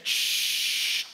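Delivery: long, sharp "shhh"; drawn-out hissed hush; urgent shushing. Acted. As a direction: shush someone to keep it secret. Hushes the clerk.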